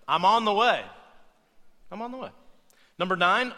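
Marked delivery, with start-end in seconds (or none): 0.1-0.8 louder, firm announcement of the lie; confident loud I'm on the way; loud claim. Shown as a demonstration. Quoting the common lie. 1.9-2.3 softer, higher muttered repeat; hushed knowing I'm on the way; quiet aside. Shown as a demonstration.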